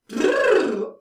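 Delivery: buzzing lip trill. Shown as a demonstration. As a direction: let the lips flutter on the breath. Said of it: "do a lip trill".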